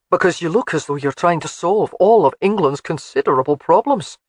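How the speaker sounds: light Scottish burr, fond and teasing; a Highland laird teasing his bride; Scottish husband Alec. Acted. Alec teases Jamie.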